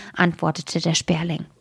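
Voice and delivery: usual speaking voice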